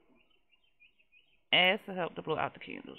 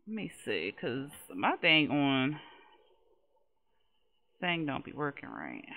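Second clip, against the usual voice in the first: normal talking voice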